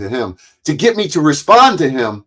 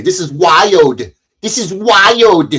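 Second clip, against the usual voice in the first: slow, drawn-out catchphrase impression, pitched up; Cynthia; 5 semitones higher, 4 dB louder, slower than usual. Quoting a woman's catchphrase to viewers, slow exaggerated mimicry. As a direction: stretch WILD out long and heavy, mimicking someone. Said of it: "as Cynthia would say".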